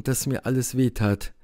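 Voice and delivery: usual speaking voice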